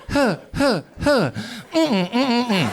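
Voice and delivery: high, loud panting huffs into a hum; mock post-climax pant; panting then humming. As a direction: gasp out of breath then hum. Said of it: "the sound I made after I finished".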